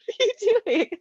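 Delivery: high, loud disbelieving cry; a dad horrified at his kid; half-laughing alarmed dad yelp; mock shocked dad. Dad shocked child eats grounds.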